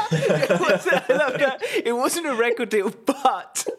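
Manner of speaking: high, loud, through real laughter; cracking up while teasing a friend; laughing quote-back. Real, not acted. Co-host echoing the bandmate's hedge about the prize, laughing teasingly.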